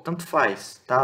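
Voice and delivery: ordinary talk, nothing marked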